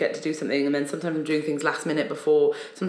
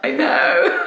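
high laughing I know; 6 dB louder than usual. Real, not acted. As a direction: laugh through it. Laughs at Very British.

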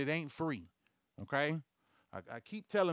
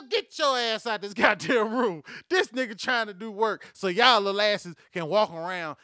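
loud, high scolding outburst; exasperated rant; 5 semitones higher, 8 dB louder than usual. Acted. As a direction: yell it like a fed-up grown-up. Acted rant at the kids.

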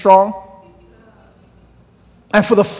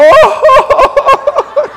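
very high, loud swooning "Oh!"; girls' delighted squeal; 4 dB louder than usual. Retold or imitated. Schoolgirls swooning over the boy's British accent, squealing. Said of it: "they would go".

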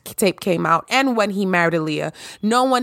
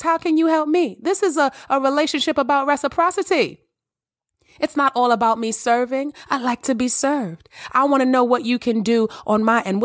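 bright, lifted host voice greeting listeners; podcast host intro; 4 semitones higher than usual. Acted. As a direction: welcome the audience like a radio host. Continues host address.

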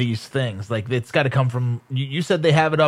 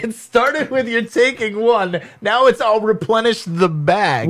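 slow, higher, mock-incredulous; teasing; 7 semitones higher, slower than usual. Real, not acted. Teasing cohost about his candy excuse, mock disbelief. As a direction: needle him about the growing excuse.

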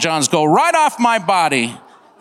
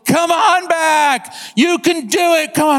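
high, stretched encouraging holler; acted calling out; 10 semitones higher than usual. Friend calling the swimmer back across, acted holler. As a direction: urge him on from far away.

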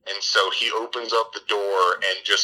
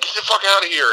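higher, louder frightened shout; mock panicked yell; 12 semitones higher, 5 dB louder, faster than usual. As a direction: yell it at the creepy lady. Scared [name] yells.

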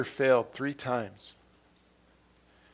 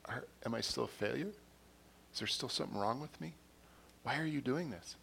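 soft, self-doubting questions, as if thinking aloud; inner voice; 10 dB quieter than usual. Retold or imitated. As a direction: act out quiet inward worry. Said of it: "Peter's thinking in his head".

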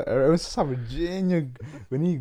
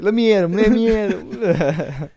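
loud, higher, hammed-up rage; mock angry shout; 6 semitones higher, 6 dB louder, slower than usual. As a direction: pretend to lunge at him. Joking threat to snitch.

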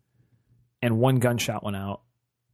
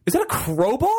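high alarmed question; 8 semitones higher than usual. Retold or imitated. Player spotting a weapon, alarmed high question. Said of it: "I remember saying exactly".

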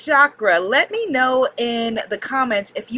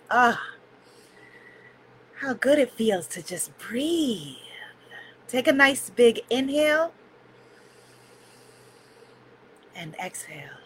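soft spa whisper; 6 dB quieter than usual. Acted. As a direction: breathe the words out gently. Leads a breathing meditation.

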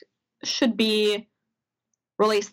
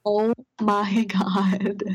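whispered oh my god; much slower than usual. Real, not acted. Overwhelmed by the story.

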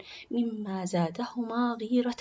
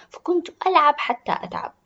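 put-on higher, louder excited child; excited little girl; 6 semitones higher, 8 dB louder than usual. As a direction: tell it with a child's delight. Girl excitedly recounts fairy's gift.

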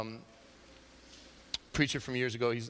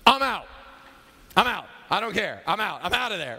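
loud, higher-pitched walkout; mock refusal; 6 semitones higher, 5 dB louder than usual. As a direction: bail out loudly, playing it for laughs. Acts patient bailing out on surgeon.

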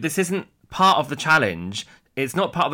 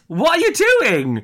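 incredulous; 12 semitones higher, 4 dB louder, slower than usual. Real, not acted. Disbelief at rude contestant.

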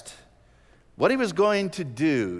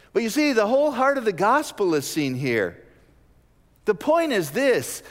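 loud, high preaching voice; rising fervent sermon delivery; preaching at full force; 9 semitones higher, 5 dB louder than usual. Acted. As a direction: proclaim it to the congregation.

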